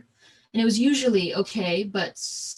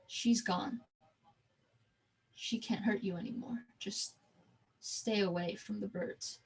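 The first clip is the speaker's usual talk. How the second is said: much softer, nearly a murmur; quiet, hushed; 7 dB quieter than usual. Retold or imitated. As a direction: zookeeper softly telling the child to stay away. Zookeeper with gun speaks gently.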